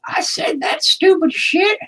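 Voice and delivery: high, slow, baffled voice; squeaky confused me; mock baffled self. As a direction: play your own dumb past self, high and drawn out. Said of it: "I'll be like".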